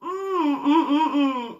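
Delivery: high laughing hums; manic giggle. Retold or imitated. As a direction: giggle wildly to yourself. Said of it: "Like, just".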